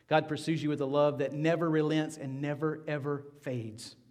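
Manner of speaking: ordinary talk, nothing marked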